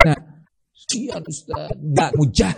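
soft, slow, pitying plea to the teacher; pleading parent. Retold or imitated. Parent pleads with the ustaz, soft and slow. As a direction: beg softly like a doting parent. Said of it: "Dengan atas kasih yang buat".

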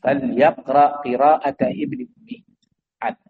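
slow, measured Arabic quotation of the Prophet; preacher quotes the Prophet's saying, slow and measured; quoted hadith. Retold or imitated. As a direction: recite the hadith deliberately, word by word. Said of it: "Nabi mengatakan".

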